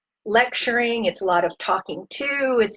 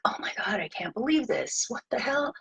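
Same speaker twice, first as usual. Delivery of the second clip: whispered and much softer, imitating a stunned parent; shocked whisper; 2 semitones higher, 8 dB quieter than usual. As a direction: hush it, as if you can't believe what you found. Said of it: "you're feeling really upset".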